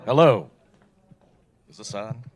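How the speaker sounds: normal talking voice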